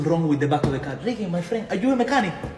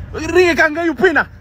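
imitating Ricky; 12 semitones higher, 10 dB louder than usual. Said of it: "He's like a".

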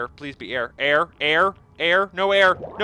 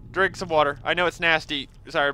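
ordinary delivery

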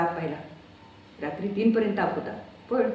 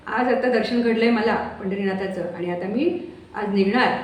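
Kusum over the phone; 3 semitones higher, 4 dB louder than usual. Acted. Kusum loudly explains from crowded Pandharpur on the phone.

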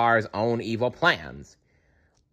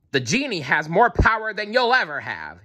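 higher, taunting jeer; 9 semitones higher than usual. A narrator's reading. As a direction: needle the villain's pride. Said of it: "he jeered".

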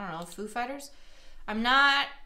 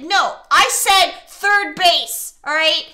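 loud, slow, raised correction; exasperated insistence; 6 semitones higher, 11 dB louder, slower than usual. Real, not acted. Player correcting the game partner on past words, exasperated. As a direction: hammer each word out loudly. Insisting on what was really said.

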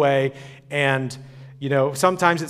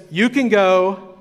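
grand Pharaoh; 4 semitones higher, 5 dB louder, slower than usual. Retold or imitated. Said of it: "Pharaoh says".

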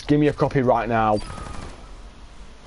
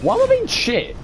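exasperated high whine. Real, not acted. Frustrated after being killed.

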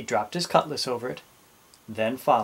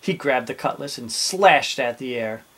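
usual speaking voice

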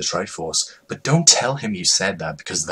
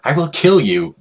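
raised, loud angry threat; furious bear yelling at his mother; 6 semitones higher, 5 dB louder than usual. Acted. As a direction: snap it out in a rage. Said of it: "he got very mad".

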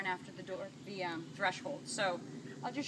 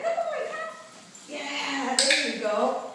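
high excited dog praise; 5 semitones higher, 7 dB louder, much slower than usual. Real, not acted.

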